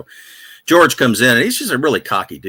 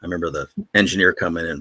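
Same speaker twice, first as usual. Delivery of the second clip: normal talking voice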